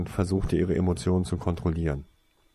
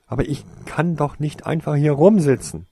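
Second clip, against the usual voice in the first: higher, louder desperate plea; 5 semitones higher, 4 dB louder than usual. A narrator's reading. Tasuin tells Toriesa he can't just sit there, desperate. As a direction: push against helplessness. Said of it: "sagte er verzweifelt".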